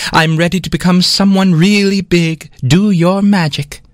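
high and grand, slowing on the command; vain eagerness. Acted. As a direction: demand it like a diva. Vain 24 demands magic.

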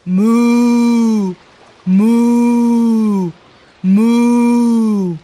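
long, drawn-out cow moo; steady cow moo. Shown as a demonstration. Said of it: "you have to moo three times".

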